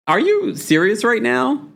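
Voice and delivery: high incredulous question; exasperated disbelief. Retold or imitated. Imagined husband fed up with Tamra, high and incredulous. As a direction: throw it up in disbelief. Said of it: "frustrated with Tamra".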